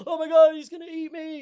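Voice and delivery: high, loud hammed-up fright; comic panicked cry; mock panic. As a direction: wail it like you're about to be eaten. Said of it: "I was just like".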